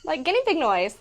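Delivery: high squeaky guinea pig squeal; guinea pig noise. Shown as a demonstration. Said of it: "I can make a guinea pig noise".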